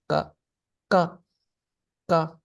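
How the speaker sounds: ka clipped short and high. Shown as a demonstration. Teacher showing the short high ka. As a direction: keep ka short and sweet. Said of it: "short and sweet".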